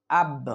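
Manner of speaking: আব with a bounced release on the final b; light qalqalah push. Shown as a demonstration. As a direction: kick lightly off the ব ending আব. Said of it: "হালকা ধাক্কা দিয়ে".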